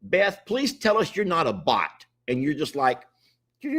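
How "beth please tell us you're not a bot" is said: ordinary talk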